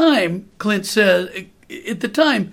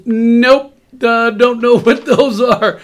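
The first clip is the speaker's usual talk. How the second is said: laughing; 3 semitones higher, 6 dB louder, slower than usual. Retold or imitated. Dad answers Clint, retold.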